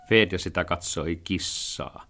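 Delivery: plain reading voice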